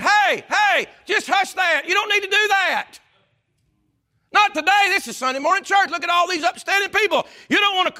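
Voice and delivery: high, loud objecting voice; scolding crowd. Acted. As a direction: protest loudly, high and insistent. Acting the crowd hushing a seeker.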